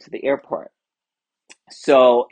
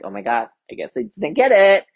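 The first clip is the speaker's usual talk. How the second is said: quick, high, louder mock dismay; retold dismay; 7 semitones higher, 6 dB louder, much faster than usual. Standby passenger seeing the doors close, acted dismay. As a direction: rush it out, pitched up, acting let down.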